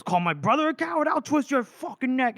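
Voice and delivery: hammed-up threat to defend his brother; mock angry threat. Scale threatens the mocker.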